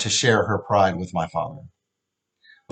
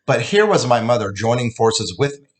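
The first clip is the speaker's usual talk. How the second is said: usual speaking voice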